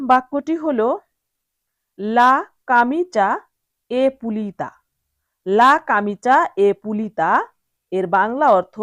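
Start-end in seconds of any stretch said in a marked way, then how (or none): 2.0-4.7 slow, word-by-word Italian sentence; slow teacher model of Italian. Shown as a demonstration. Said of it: "teacher models Italian sentence". 5.5-7.3 slow, careful repeat of the Italian; slow repeated teacher model; slow model sentence. Shown as a demonstration. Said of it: "teacher repeats model sentence".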